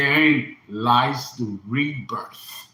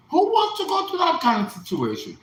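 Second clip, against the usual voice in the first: leaps much higher in disbelief; incredulous outburst; 13 semitones higher than usual. Real, not acted. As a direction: fling the question up high. Rhetorical outburst.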